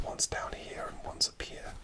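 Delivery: ordinary talk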